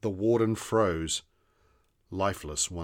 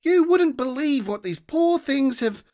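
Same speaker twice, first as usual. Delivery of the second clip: put-on much higher, louder, exasperated child; frustrated girl; 7 dB louder than usual. A narrator's reading. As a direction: burst out in frustration. Rosa still frustrated.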